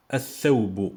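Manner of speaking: "Al-Thawbu" with L melted into a long th; no L in "Al-Thawbu", th held instead; silent L, doubled th. Shown as a demonstration. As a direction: say "Al-Thawbu" as ath-thawbu, leaning on the th. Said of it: "je dis pas Al-Thawbu".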